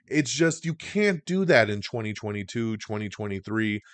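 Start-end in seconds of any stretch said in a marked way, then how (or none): none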